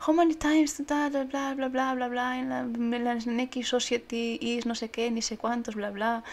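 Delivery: loud, high mocking babble; hammed-up bored parody; mocking letter. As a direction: parody the boring text.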